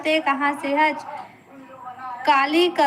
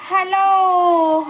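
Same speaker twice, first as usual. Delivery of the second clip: long, stretched-out hello; drawn-out greeting; 3 semitones higher, 4 dB louder, much slower than usual. Real, not acted. Host hails a live-stream viewer, drawn-out greeting. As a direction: stretch the hello out as you call it.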